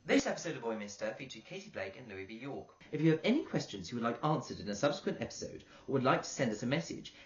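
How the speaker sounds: steady announcer read of the credits; podcast outro read. Acted. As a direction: read the sign-off like a show host.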